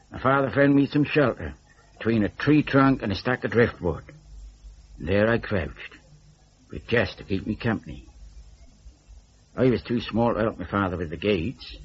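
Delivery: usual speaking voice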